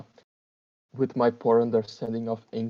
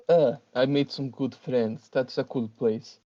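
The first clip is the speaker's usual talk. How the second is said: bad Russian accent. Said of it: "this bad Russian accent".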